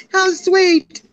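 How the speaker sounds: high, laughing oh how sweet; touched and excited. Retold or imitated. Retelling her reply to her friend, high and laughing. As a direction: squeal it through a laugh. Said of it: "And I was like".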